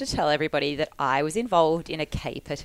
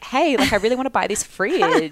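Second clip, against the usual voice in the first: higher, louder keen buyer voice; eager buyer; 7 semitones higher, 5 dB louder than usual. Retold or imitated. Friend scripting a fake buyer message, higher and keener. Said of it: "and be like".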